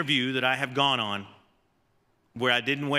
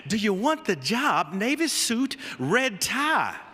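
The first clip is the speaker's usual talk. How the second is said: Coach Broyles drawl; 6 semitones higher, slower than usual. Retold or imitated. Head coach insisting on the dress code, firm Southern drawl. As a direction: lay down the law in a Southern drawl. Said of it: "In that sweet Southern drawl".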